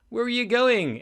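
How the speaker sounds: high, loud question; puzzled calling out. Acted. Terry asks Andy where he is going, puzzled call. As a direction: call after someone rushing past.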